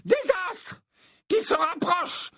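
very high, slow, ominous prophecy; prophesying seer. Acted. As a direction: foretell the disaster with theatrical dread. Trelawney theatrical prophecy.

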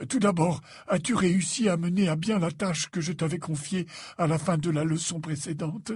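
put-on wise old headmaster. A narrator's reading. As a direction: confide gently in a trusted pupil. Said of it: "poussa un profond soupir".

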